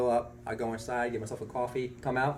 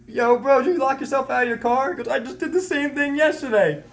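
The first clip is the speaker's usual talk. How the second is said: much higher and louder, buddy-buddy; excited store owner; 13 semitones higher, 9 dB louder than usual. Retold or imitated. Store owner tells the narrator he did it too, high excited. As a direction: greet him like an old pal who gets it. Gas station guy voiced in story.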